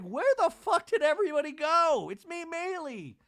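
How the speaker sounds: calling out, lost and left behind; confused outcry. Retold or imitated. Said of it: "Maylee's like".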